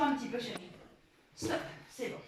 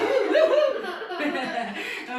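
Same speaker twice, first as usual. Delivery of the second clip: éclat de rire; 5 semitones higher, 5 dB louder than usual. Real, not acted. Ball game mishap, laughing.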